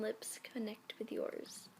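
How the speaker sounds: slow hushed whisper. Acted. Reading the kiss scene aloud, slow and whispered. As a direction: whisper it slowly, savouring. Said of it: "reading the kiss line hushed".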